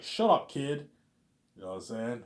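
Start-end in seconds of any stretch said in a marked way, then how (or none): 0.0-0.9 louder, higher gruff cop brushing him off; mock cop snap. Cop shuts up the pleading kid, loud mock snap. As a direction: bark it like a dismissive cop. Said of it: "the cop's like".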